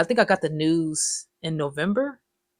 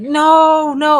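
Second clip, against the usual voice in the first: protesting no; 10 semitones higher, 6 dB louder than usual. Retold or imitated. Child refusing to enter school.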